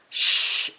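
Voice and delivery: SH as one long unvoiced hush sound; isolated sh sound. Shown as a demonstration. Teacher sounding out the first sound for the class, isolated "Sh". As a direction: hold "Sh" as a single drawn-out consonant. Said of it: "I can hear that".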